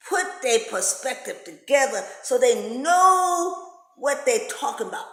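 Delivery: normal talking voice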